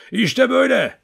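high, loud wolf howl. A narrator's reading. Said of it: "kurt gibi ulumaya başladı". (kurt gibi uluyarak)

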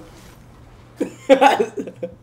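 long high laughing fit. Real, not acted. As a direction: laugh helplessly. Laughing at friend's mic.

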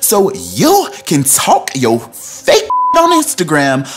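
mock angry read. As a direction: throw the threat like a furious rival. Reading Nene's angry text.

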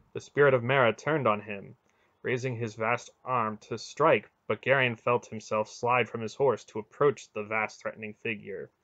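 ordinary delivery

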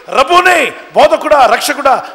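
high, loud call of recognition; cry of recognition. Retold or imitated. Mary recognises the Lord's voice, high excited call. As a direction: cry out to the teacher you just recognised. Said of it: "స్వరాన్ని గుర్తుపట్టి".

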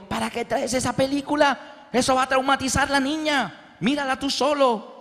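high, reproachful voice; wife complaining about the movie; scolding wife. Retold or imitated. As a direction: call out from the kitchen in protest. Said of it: "Mi esposa ... dijo".

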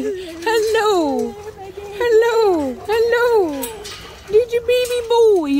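high, sing-song cooing to a dog. Real, not acted. Owner coos at her good boy, high doting baby talk.

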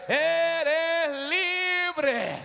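long, sustained preacher's cry; drawn-out proclamation. Real, not acted. Congregant to the neighbour, proclaiming freedom. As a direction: stretch each word out as a proclamation.

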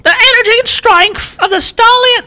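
hammed-up British accent, very high and strained. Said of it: "all this in a British accent".

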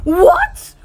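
sudden high, loud what; shocked what. Acted. Boy shocked when the game breaks, loud high what. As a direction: jolt up in disbelief.

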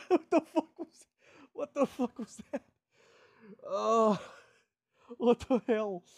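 high, loud, shocked exclamation; real startled outburst; startled disbelief. Real, not acted. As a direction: react to something bizarre you just heard. Shocked by own odd sound.

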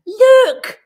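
very high, loud screech. A narrator's reading. Smallest gorilla spots something, screeching. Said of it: "screeched Abu".

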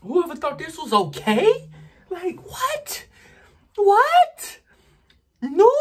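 squealing in disbelief; high disbelief. Real, not acted. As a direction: shriek it in outrage. Outraged at spice.

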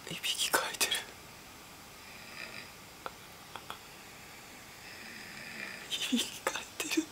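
soft unvoiced whisper. Real, not acted. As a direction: whisper it under your breath. Listening to snore recordings at night. (ささやき声)

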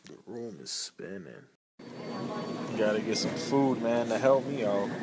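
low, quiet, sick and slurring; barely able to talk, about to vomit. Real, not acted. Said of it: "I'm gonna throw up".

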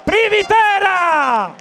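breathless italian goal commentary. Real, not acted. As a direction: yell the goal, slow and drawn out. Second goal in live commentary.

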